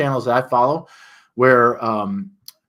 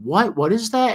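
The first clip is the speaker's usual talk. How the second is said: confused what; 11 semitones higher, 4 dB louder than usual. Retold or imitated. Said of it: "I'm like".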